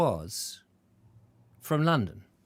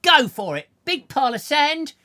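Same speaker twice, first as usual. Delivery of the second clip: mock commands; 10 semitones higher, 7 dB louder than usual. Ordering the stars about.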